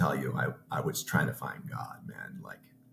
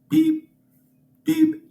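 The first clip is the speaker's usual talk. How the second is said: very high, loud electronic beep; piercing mock monitor beep; heart monitor beep; 10 dB louder than usual. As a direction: mimic a monitor's sharp beep. Imitating heart monitor beeps.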